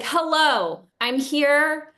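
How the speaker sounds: loud, drawn-out oblivious greeting. Retold or imitated. Late-comer announcing herself to a sleeping class, loud. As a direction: imitate someone announcing themselves too loudly. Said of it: "being so loud".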